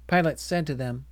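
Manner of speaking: ordinary delivery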